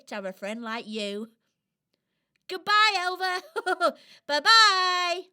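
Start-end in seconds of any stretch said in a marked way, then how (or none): 2.5-3.9 cheerful goodbye. Acted. Cheerful farewell, loud. 4.3-5.2 cheerful goodbye. Acted. Cheerful farewell, loud.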